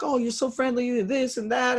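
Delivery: a hint of a high-energy guy; upbeat interviewee. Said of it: "He's like".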